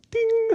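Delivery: high sung ding. Acted. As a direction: chime like an idea lighting up. Puppet idea chime.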